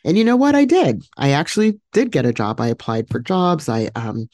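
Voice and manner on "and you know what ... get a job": triumphant reveal. Real, not acted. Triumphant payoff of her story.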